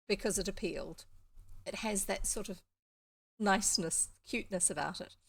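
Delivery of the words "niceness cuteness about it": ordinary delivery, nothing marked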